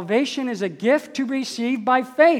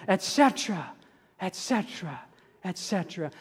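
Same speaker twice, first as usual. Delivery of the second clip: et cetera dropping softer each time until nearly gone; fading away; 3 semitones lower, 7 dB quieter than usual. Real, not acted. Preacher trailing off his list, fading to near silence. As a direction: let each et cetera fade out.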